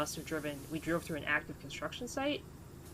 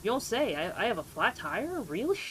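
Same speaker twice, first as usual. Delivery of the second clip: mock amazed sarcasm; 5 semitones higher, 4 dB louder than usual. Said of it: "I was a little sarcastic with them".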